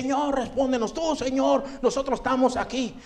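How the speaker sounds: pleading voice. Retold or imitated. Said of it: "decían".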